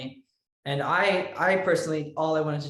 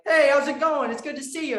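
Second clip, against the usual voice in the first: high, loud, jovial hello; put-on chummy burst, pitched way up; imitated hearty greeting; 11 semitones higher, 6 dB louder than usual. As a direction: greet an old pal, acting it out big. Imitates co-star's friendly greeting off camera.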